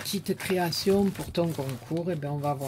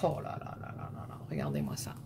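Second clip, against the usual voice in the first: breathless, hushed and much lower, voice almost gone; awed whisper; 14 semitones lower, 9 dB quieter, faster than usual. Real, not acted. Vlogger unboxing a card from Annie, stunned whisper. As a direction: whisper it like you can't believe your eyes.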